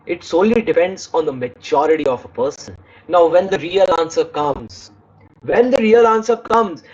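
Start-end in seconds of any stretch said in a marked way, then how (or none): none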